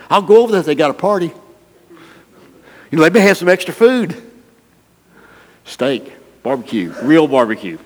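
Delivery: greedy churchgoer, hammed-up and quick; eager mock glee. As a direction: perk up at the thought of free food. Selfish churchgoer voiced.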